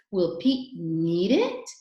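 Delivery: drawn-out question reading, ending on a strong rise; question intonation. Shown as a demonstration. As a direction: raise the voice sharply on the final "it". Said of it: "your voice goes up".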